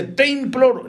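high-pitched pleading cry; acted cry. Preacher quotes the Gadarene imploring Jesus, high acted cry. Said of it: "gritó".

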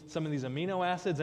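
ordinary delivery

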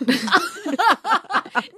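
bright high laugh. Real, not acted. Laughs after compliment.

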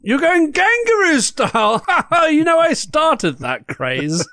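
high, loud camp diva greeting; campy drag queen. Acted. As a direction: gush like a flamboyant hostess. Campy host persona.

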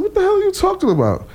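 shocked. Retold or imitated. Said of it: "I said".

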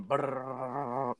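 rolled-lips mock drumroll; drumroll imitation. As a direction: imitate a drum roll with rolled r sound. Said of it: "So everybody starts going".

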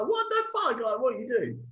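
high, startled what the fuck; shocked. Retold or imitated. Storyteller being swung around, shocked and high. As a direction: yelp it out in disbelief. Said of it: "and I was like".

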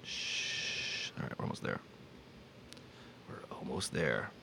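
hushed, breathless whisper. Pretend. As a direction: hush it to a whisper. Whispered hush.

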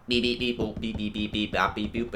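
flat mechanical beeps; robot beeping. Retold or imitated. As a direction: beep like a computer. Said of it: "some fucking A I algorithm saying".

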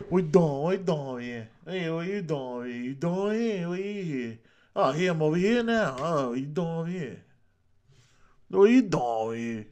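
put-on New York accent. Host clowning about what we're doing here, hammed-up New York accent. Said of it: "I'm doing the New York accent".

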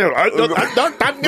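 put-on comically mad, garbled accented voice; high, slurred mock outrage in a thick accent; mock mad caller. Caller getting mad at mom, mock-angry accent. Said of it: "And then he got mad".

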